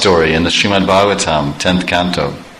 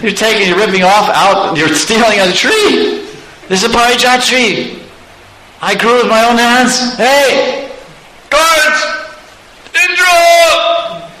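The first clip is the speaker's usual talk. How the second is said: high, indignant cry for help; outraged gardener; 11 semitones higher than usual. Acted. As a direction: protest high-pitched, then holler for the guards. Gardener protests, calls the guards.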